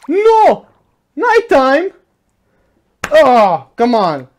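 high loud outcry; frustrated shout. Real, not acted. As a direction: yell at the game. Night falls in game.

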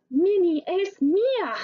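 high, jealous claim; a lightly voiced jealous cartoon mouse; possessive Mickey. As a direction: stake your claim on her like a jealous little mouse. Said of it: "Mickey dijo".

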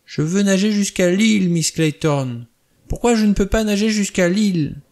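put-on high, loud child's voice pleading to swim; a spoiled little boy begging his governess, pitched high and loud; whining child. Spoiled boy begs Miss Clayton to let him swim, high whining child. Child Cyril whines on the beach.

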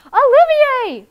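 very high, loud, drawn-out shout; shouted name. Acted. Owner shouting after his runaway cat, very loud. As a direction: call the runaway cat back at full voice. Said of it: "Mister Sims called out".

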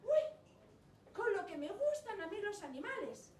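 a prince thrilled about animals, squealing happily; delighted prince. Acted. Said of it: "príncipe que dijo".

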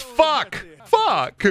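very high, theatrical cartoon exclamation; Snagglepuss. Snagglepuss swears, high hammy voice. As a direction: sing out the curse cartoonishly. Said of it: "Snagglepuss says".

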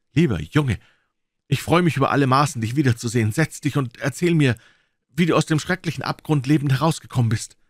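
high, loud and eager; joyful excitement. Acted. Watson to his returned friend, high joyful eagerness. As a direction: bubble over with joy.

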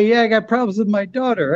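a light Rodney setup line; Rodney Dangerfield voice. Retold or imitated. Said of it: "then he told me".

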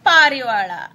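"parivala" said the Kannada way; Kannada word. Shown as a demonstration. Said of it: "In Kannada we say".

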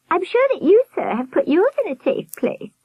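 very high warbling falsetto; advising nurse. A narrator's reading. Nurse advising Albert, high-pitched warble. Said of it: "the high-pitched voice".

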